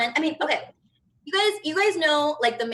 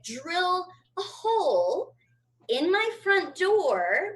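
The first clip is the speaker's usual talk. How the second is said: slow; 5 semitones higher, much slower than usual. Real, not acted. Slow deliberate pitch of idea.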